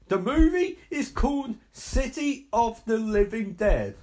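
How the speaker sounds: high, loud, emphatic correction; indignant rant. Real, not acted. Viewer complains about film title, loud indignation. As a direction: hammer out the title in exasperation. Rants that title says city.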